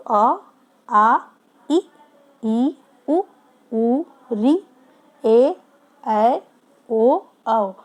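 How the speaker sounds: अ to औ drawn out one by one, tone shifting with each vowel; vowel recitation. Shown as a demonstration. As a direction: demonstrate how the tone changes across the vowels. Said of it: "कैसे कैसे आपका tone change होता जा रहा है". (स्वरों का धीमा, स्पष्ट उच्चारण)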